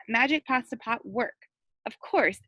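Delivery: ordinary delivery, nothing marked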